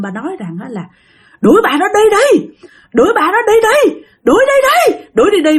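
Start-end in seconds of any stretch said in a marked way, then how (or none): 1.4-5.6 very high, raised-voice frantic cry; retold hollering. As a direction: holler it over and over like the panicked husband. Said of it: "mà ba gào, ba la lên như vậy đó". (giọng gào la the thé, bắt chước người chồng)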